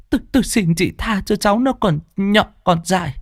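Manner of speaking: put-on high, begging old woman's plea; pleading. Bà Nhâm begs the neighbour to spare her nephew, high pleading. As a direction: beg her for mercy. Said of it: "van mà lạy người ta".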